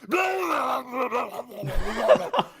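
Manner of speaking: loud, high mock yelling; imitated hallway screaming. Ruffians coming up the theater hallway, mock screaming. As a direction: holler like a rowdy ruffian. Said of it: "I hear this coming up the theater hallway".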